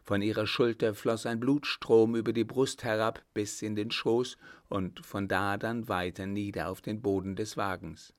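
ordinary delivery

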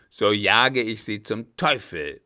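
loud, furious outburst; flaring up. Acted. Captain throws the German out, flaring up loudly. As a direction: blow up at him. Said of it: "brauste der Alte auf".

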